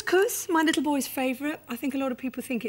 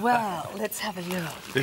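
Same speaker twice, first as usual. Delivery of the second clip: slow, lower seductive purr; sexy voice; 4 semitones lower, slower than usual. Shown as a demonstration. Actress asked to show her bag in a sexy voice, sultry. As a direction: draw it out low and sultry. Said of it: "tell us in a sexy voice".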